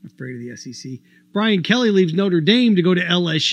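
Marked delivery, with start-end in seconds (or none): 0.1-1.0 much softer, lower mock repeat of "Afraid of the S E C"; scornful mutter. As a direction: toss "Afraid" away under your breath in disbelief. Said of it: "It's ridiculous".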